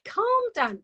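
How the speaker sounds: drawn-out, high-pitched mock pleading to calm down; urging calm. Bystanders telling a panicker to calm down, exaggerated urging. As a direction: stretch it out, pitch swooping up then falling. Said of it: "everyone's going".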